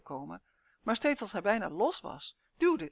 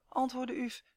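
plain reading voice

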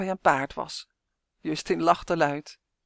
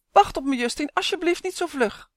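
desperate, pitched-up appeal; pleading call; 9 semitones higher than usual. A narrator's reading. Struggling elf begs companion to wait.

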